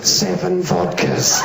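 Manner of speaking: lightly voiced toilet, very slow; talking toilet. Toilet answering the hippo, drawn out. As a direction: draw the words out as a mock reveal.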